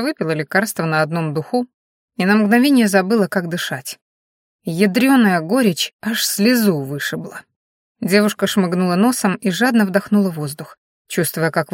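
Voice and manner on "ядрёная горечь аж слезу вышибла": usual speaking voice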